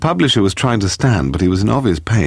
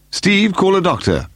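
high, moderately raised shout; 13 semitones higher than usual. A narrator's reading. Paul orders Steve to get a doctor, shouting. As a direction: call the order out, pitch well up. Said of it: "Paul shouted".

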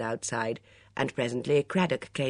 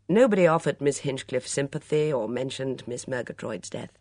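ordinary delivery

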